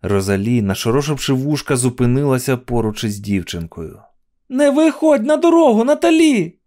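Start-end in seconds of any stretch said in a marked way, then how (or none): 4.5-6.6 high, loud cry of alarm; very high warning shout. A narrator's reading. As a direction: call out urgently to stop a child. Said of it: "крикнула дівчинці Луїза".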